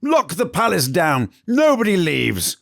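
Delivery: high, loud barked command; furious queen commanding the guards; shouted order. Acted.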